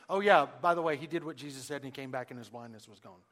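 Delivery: quick, casual throwaway after the opening words; afterthought. Acted. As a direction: toss it off as a by-the-way. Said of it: "in almost an afterthought".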